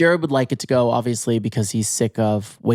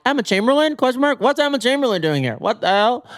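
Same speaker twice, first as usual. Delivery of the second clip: much higher, mock baffled commenter voice; confused commenters; 11 semitones higher than usual. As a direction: play a bewildered fan squealing in the comments. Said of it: "it's all like".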